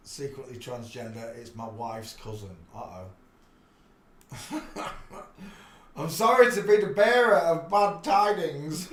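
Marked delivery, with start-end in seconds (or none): 6.0-8.9 loud, higher, playful apology; loud, higher, teasing fake apology; mock apology. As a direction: ham up the sorry messenger. Mock apology after gossip.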